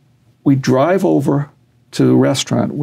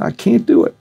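high-pitched Southern kid impression; Southern kid accent; 9 semitones higher than usual. Frightened fighter tells his trainer he can't, mock Southern accent. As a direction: retell it in a much higher Southern voice. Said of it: "He's a Southern kid".

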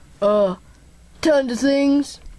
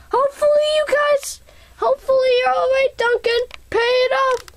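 high squeaky voice; 11 semitones higher, faster than usual. Acted. High fast farewell voice.

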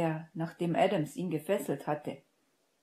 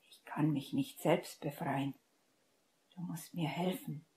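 soft unvoiced urgent whisper; 6 dB quieter than usual. A narrator's reading. Bound Severus urges Hermine to free him, whispering. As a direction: keep it hushed so the guards can't hear.

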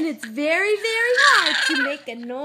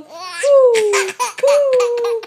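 loud, higher hooting coo; owl hoot; 5 semitones higher, 9 dB louder, much slower than usual. Acted. Reading to a child, hooting like an owl. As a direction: call like an owl. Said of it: "He could sound like an owl".

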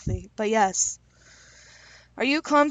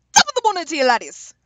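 a hammed-up Irish accent, higher and louder; bad Irish accent; 7 semitones higher, 7 dB louder, faster than usual. Greeting Jacksepticeye's picture, mock Irish accent. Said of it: "I know my accents suck".

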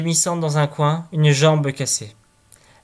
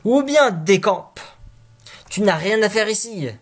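higher, slowed warning; threatening boy; 5 semitones higher than usual. Acted. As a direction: order the ghost away.

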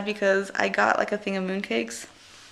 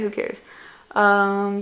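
ordinary talk, nothing marked

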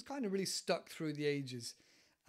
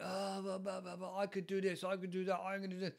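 yapping boaster, imitated; mimicking someone all talk; mock big-mouth attitude; 3 semitones higher than usual. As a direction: rattle off empty boasts in a mocking drone. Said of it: "maybe a lot of attitude".

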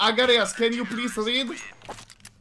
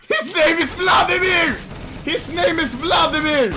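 high, loud announcement; excited shout, hammered out twice; hyped shouting; 7 semitones higher, 5 dB louder than usual. Real, not acted. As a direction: yell it out in delight. Hyped shouting about teammate's name.

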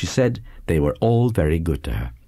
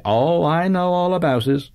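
high, knowing teasing voice; teasing matron; 10 semitones higher than usual. A narrator's reading. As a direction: tease her like a nosy aunt. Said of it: "as much as to say".

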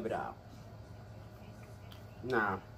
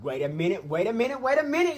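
building excitement; 9 semitones higher, 7 dB louder than usual. Acted. Comedic intro mimicking song clip.

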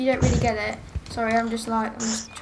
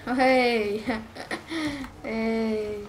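normal talking voice

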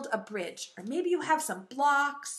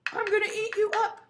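raised troll voice; troll threat; 8 semitones higher than usual. Acted. Troll threatening the big goat, high.

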